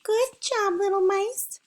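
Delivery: very high, tiny squeaky voice; little mouse voice. Pretend. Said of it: "let's do it in a little mouse voice".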